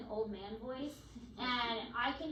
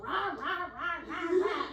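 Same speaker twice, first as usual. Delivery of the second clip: put-on old man voice; 4 semitones higher, 5 dB louder, slower than usual. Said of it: "in this old man voice".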